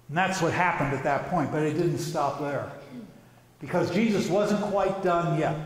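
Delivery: ordinary delivery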